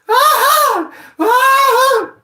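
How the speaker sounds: shrill high yelp of fear; terrified scream. Retold or imitated. Retelling the dog attack, he screams in fear. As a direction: scream like the dog just grabbed you.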